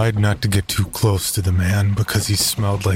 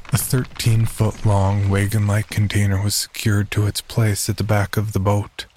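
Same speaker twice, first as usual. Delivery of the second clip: ordinary delivery, nothing marked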